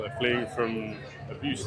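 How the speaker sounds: normal talking voice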